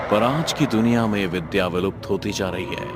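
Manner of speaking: ordinary delivery, nothing marked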